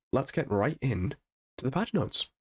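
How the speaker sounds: normal talking voice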